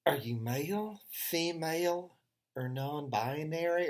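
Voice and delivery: lightly voiced young clerk; quoting the clerk. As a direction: read the form question like a clerk. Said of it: "and goes".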